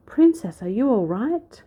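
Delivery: high, loud worried call; cried out. A narrator's reading. Townsman rushing up the hill, crying out high. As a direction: call up to her in alarm. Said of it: "cried one of the men".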